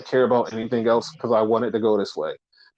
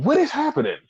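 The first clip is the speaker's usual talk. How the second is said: amazed disbelief; 13 semitones higher, faster than usual. Real, not acted. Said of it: "host's own amazement".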